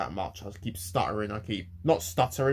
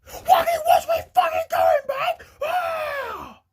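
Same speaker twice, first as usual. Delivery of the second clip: high-pitched screamed outburst; imitated furious kid screaming at full force; top-of-lungs yell; 6 dB louder, much slower than usual. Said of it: "yelled at me at the top of his lungs".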